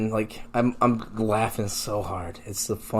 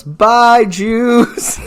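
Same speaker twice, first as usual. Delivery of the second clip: put-on high, loud child's farewell; little girl; 11 semitones higher, 10 dB louder than usual. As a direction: call out like a waving little girl. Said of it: "this little German girl who waves and goes".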